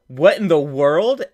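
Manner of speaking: loud, drawn-out amazed question; hyped. Real, not acted. Streamer seeing the game clip, hyped. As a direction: blurt it out in real disbelief. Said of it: "I'm already so hyped".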